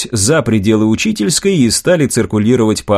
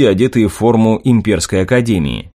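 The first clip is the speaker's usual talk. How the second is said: ordinary delivery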